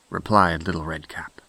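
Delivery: usual speaking voice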